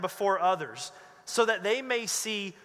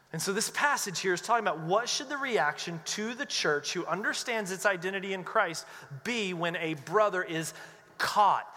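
normal talking voice

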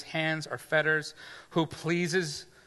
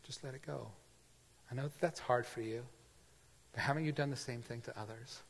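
gentle voice of God; 3 semitones lower, 11 dB quieter, faster than usual. Acted. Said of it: "God's saying".

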